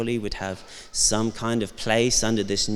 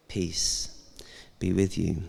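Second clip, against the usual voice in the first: gentle blessing; 3 semitones lower, 7 dB quieter than usual. Pretend. Repeating Jesus' greeting softly.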